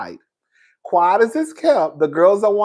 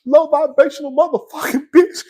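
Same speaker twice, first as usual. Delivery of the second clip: high-pitched mock-sobbing tirade; broken, weepy insults, pitched high; crying while cussing; 7 semitones higher than usual. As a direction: cuss someone out through put-on tears. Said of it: "that'll cry and cuss your ass out at the same time".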